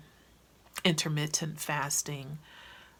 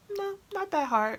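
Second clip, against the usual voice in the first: imitating blasé friend; 13 semitones higher than usual. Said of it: "She was so blasé about it".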